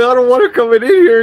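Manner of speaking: loud acted outburst; retold shout. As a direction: yell it out as in the standoff. Shouted quote at breach.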